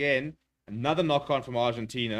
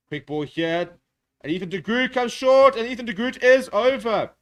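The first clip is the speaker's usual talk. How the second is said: much higher and louder, shouting the try; excited try call; 10 semitones higher, 11 dB louder, slower than usual. Real, not acted. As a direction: yell it as he goes over. Try being scored live.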